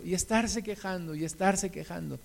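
a weary, fading echo; fading repetition. Shown as a demonstration. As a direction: let the repeated complaint die out. Repetition trails off softly.

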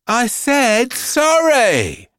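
put-on high, slow, whiny child voice; cheeky child. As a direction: drag out a sassy retort. Cheeky girl answers back.

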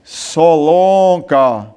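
low, slow drawn-out call of the name; deep slow army captain calling a recruit; captain barking a name. Retold or imitated. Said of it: "o capitão disse assim".

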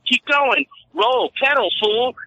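high, mocking shooing-off; taunting send-off. Guard shooing the bike guy away, high mocking voice. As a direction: shoo him away with scorn. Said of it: "just like".

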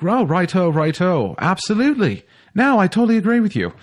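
high, plummy put-on English accent; mock English accent. Podcast host mocking himself talking to an English caller, English accent. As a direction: ham up a chatty English accent. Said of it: "whenever I talk to somebody in an English accent".

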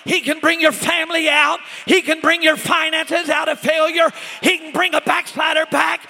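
high, shouted preaching, words hammered out; full-force preaching. Acted. Preacher rousing the church with God's power, full-force preaching. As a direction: shout it like a revival preacher at the climax.

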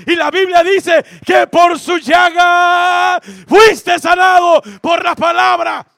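high, very loud, slow preacher's cry; preaching shout. Acted. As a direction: thunder the victory over sickness. Prédication à pleine force.

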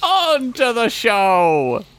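high, drawn-out announcer voice; announcer call. Acted. Cohost kicking off the episode, announcer call. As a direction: belt it like a showman.

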